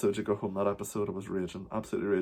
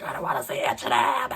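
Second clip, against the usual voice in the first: imitating an outraged diva, pitched way up; 3 dB louder than usual. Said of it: "She is fucking raging. She's like".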